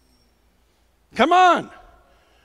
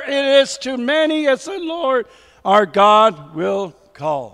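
normal talking voice

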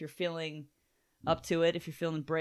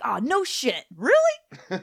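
high squeal of mock amazement; hammed-up, overjoyed surprise; super excited; 7 semitones higher, 6 dB louder, much slower than usual. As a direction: pitch leaps up in thrilled disbelief. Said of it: "He's super excited about it".

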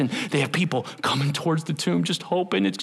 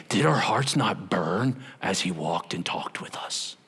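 awed wonder; 8 semitones lower, 4 dB quieter than usual. Acted. Disciples marvel, quoted.

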